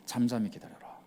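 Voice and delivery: dropping to a near-silent "잠잠히"; quiet hush. Shown as a demonstration. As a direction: let the words sink to a hush. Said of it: "우리의 본성과 반대되는 기도".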